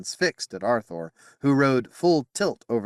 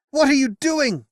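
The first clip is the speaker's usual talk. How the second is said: much higher and louder angry cry; gnashed; 7 dB louder than usual. Acted. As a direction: snap it out in alarm. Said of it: "Bedivere gnashed".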